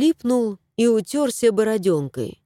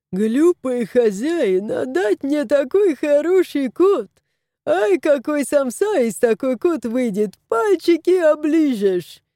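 put-on Caucasian accent; 5 semitones higher than usual. A narrator's reading. Vet mutters about the cat, in a Caucasian accent.

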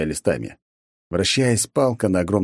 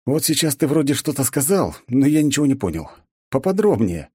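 higher, mocking demand; needling; 8 semitones higher than usual. A narrator's reading. Hero needles the smug god, higher and mocking. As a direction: tease the god pitched up.

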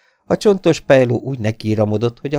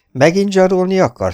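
high, loud taunt; mocking; 12 semitones higher, 8 dB louder than usual. A narrator's reading. Familiar figure interrupts Stanley from the doorway, mocking. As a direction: jeer at the blackmailer. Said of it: "egy jól ismert gúnyos hang".

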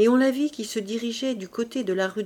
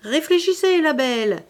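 high, raised calling voice; cri d'homme enroué; 5 semitones higher, 5 dB louder than usual. A narrator's reading. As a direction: shout after her to tempt her back. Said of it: "la voix enrouée de l'homme qui lui criait". (appel crié, voix haute et forte)

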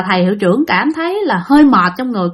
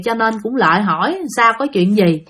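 ordinary talk

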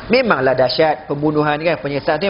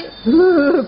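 high put-on sob; mock crying mother; 11 semitones higher than usual. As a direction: whimper like a mum losing her child. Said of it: "mak pula nangis".

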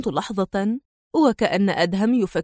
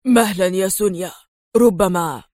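lower, hesitant interjection, broken by pauses; timidly brave man; 3 semitones lower than usual. A narrator's reading. David daring to interrupt Sonia, tentative. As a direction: gather a little courage and object. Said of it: "وجد ديفيد لديه الشجاعة ليقول".

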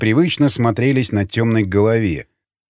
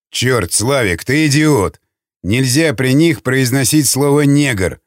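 usual speaking voice

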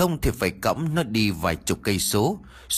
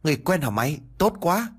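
rising to a much higher happy cry; overjoyed; 6 semitones higher than usual. Acted. Narrator rejoices that help has come, much higher and glad. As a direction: let the relief burst out. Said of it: "Tôi mừng ra mặt".

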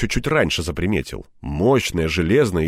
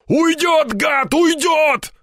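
high, drawn-out alarmed shout; 4 dB louder, much slower than usual. A narrator's reading. Степаныч sees the gunman escaping, shouting. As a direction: cry out that he's escaping. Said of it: "закричал Степаныч".

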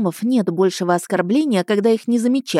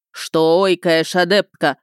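very slow, louder, gruff gnome voice; snorting gnome; 3 dB louder, slower than usual. A narrator's reading. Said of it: "фыркнул на меня недовольный гном".

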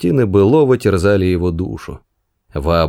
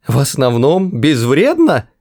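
outraged cry; 6 semitones higher than usual. Acted. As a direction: throw the words back in shock. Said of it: "вскричал Артур".

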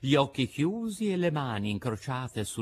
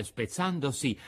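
usual speaking voice

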